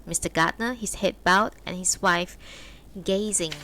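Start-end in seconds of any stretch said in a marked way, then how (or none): none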